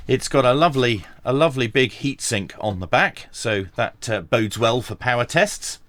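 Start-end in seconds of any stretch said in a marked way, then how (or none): none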